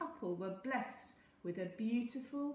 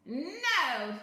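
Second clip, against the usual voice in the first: sneering; 5 semitones higher, 11 dB louder than usual. A narrator's reading. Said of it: "sneered the little man".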